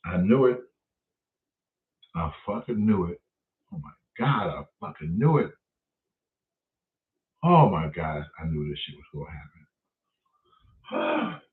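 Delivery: low, soft muttering to himself; hushed, stunned realization; quiet disbelief. Retold or imitated. Homeowner realizing squatters took his house, low and soft.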